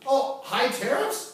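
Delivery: high, slow mock astonishment; playing dumb, then pretending to be amazed; mock surprise. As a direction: feign surprise at the obvious.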